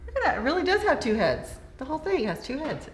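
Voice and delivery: high, loud amazed exclaim; surprised. Real, not acted. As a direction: marvel out loud at it. Sees two-headed animal exhibit.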